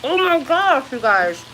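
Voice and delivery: loud, delighted exclamation; gushing. Real, not acted. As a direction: gush over the taste. Tasting the dessert.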